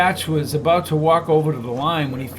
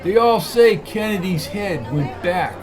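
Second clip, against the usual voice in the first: put-on New York accent; 4 semitones higher, 3 dB louder than usual. A narrator's reading. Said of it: "He had a thick New York accent".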